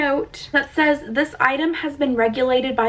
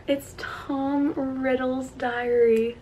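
ordinary talk

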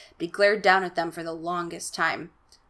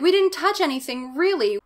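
lying Casey; 8 semitones higher, 5 dB louder than usual. Retold or imitated. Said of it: "lies out his ass".

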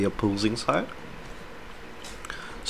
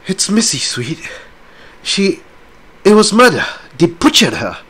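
high and loud, voice near breaking; distraught reveal; 9 semitones higher, 5 dB louder than usual. Acted. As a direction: blurt out the horror. Rose reveals the murder.

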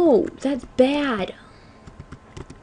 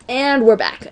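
louder, punched-up return announcement; show-host comeback; 5 dB louder than usual. Shown as a demonstration. Gamer replaying the return line for viewers, punched-up host delivery. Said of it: "I just wanted to say".

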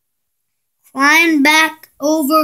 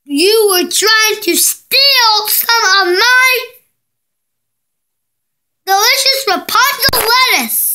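high indignant witch scolding; 6 semitones higher than usual. Acted. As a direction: scold like an outraged old witch. Angry witch confronts the man.

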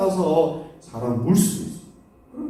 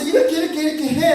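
mock scolding, pitched high; high, insistent mock telling-off; angry scolding; 8 semitones higher, faster than usual. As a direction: pretend to order someone around crossly. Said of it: "막 화를 낼 수도 있어요".

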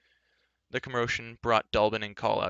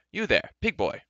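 high, loud imperious hail; haughty call; 8 semitones higher, 5 dB louder than usual. Acted. Haughty rider hailing a farm boy, imperious call. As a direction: call out sharply to a servant, pitched up.

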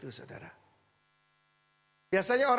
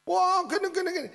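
mock argumentative; 10 semitones higher, 5 dB louder than usual. Preacher mimics himself arguing with people.

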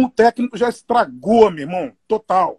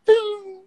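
much higher pinged tim like a ride bell; vocal ride cymbal; 11 semitones higher than usual. Shown as a demonstration.

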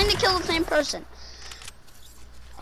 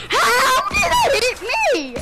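raging gamer; 5 semitones higher than usual. Real, not acted. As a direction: yell it in disbelief.

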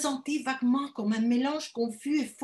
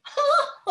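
high mocking titter; a detestable little laugh; nasty little laugh; 6 dB louder than usual. A narrator's reading. Mother laughs off her daughter to guests, nasty titter. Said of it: "son petit rire détestable".

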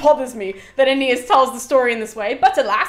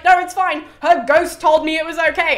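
high, loud mock reassurance; mock self-justification; 7 semitones higher, 8 dB louder than usual. Aeneas excuses himself over his wife's death, mock-reassuring. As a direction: brush it off with exaggerated insistence.

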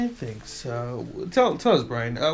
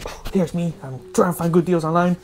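mock vlogger voice; 4 semitones higher, 9 dB louder than usual. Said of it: "So you go".

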